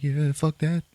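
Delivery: high-pitched mock-scared refusal. Spooked sharpshooter to his friend, mock-scared refusal. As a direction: back out with retold nerves. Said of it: "He's all scared".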